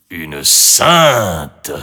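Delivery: loud, drawn-out outcry; shamed dragon. A narrator's reading. Dragon answers the saintly girl, loud and ashamed. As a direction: exclaim it as the wounded outcast. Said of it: "reprit la voix sur un ton de honte".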